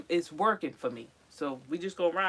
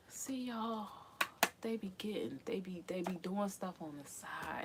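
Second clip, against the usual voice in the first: 1 whispered complaint; 10 dB quieter than usual. Real, not acted. Complaining about cats.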